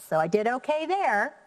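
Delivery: usual speaking voice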